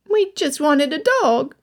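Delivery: put-on high, eager little boy; young boy voice. A narrator's reading. Young lad to Elizabeth, high eager boy voice. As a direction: play the lad pleading for a dog.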